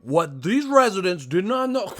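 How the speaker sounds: high, measured newscast voice; TV reporter. Retold or imitated. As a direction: imitate a reporter narrating a telecast. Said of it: "that motherfucker switched up so quick".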